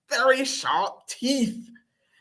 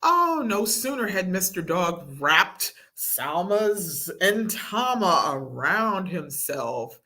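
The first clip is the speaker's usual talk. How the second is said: ordinary delivery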